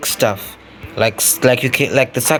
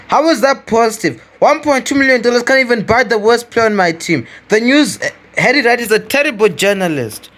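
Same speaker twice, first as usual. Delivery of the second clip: higher, indignant scoff; incredulous outrage; 7 semitones higher than usual. Real, not acted. Gamer mocking the in-game press, higher and indignant. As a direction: sound baffled and offended by the headline.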